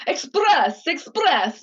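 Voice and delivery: high, slow, gushing teacher voice; mock-urge the student to emote; emotional piano teacher. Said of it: "she was very emotional".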